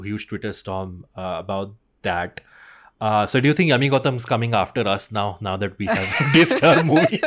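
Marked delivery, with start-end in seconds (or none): 6.1-7.2 louder, laughing through the words; cracking up mid-question. Real, not acted. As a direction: giggle out the guilty joke. Jokes star will come after them.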